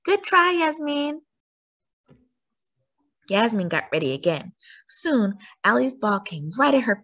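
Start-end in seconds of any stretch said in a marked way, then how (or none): 0.0-1.2 high, encouraging call. A narrator's reading. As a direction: call encouragement across the pitch. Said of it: "Coach Garcia called out".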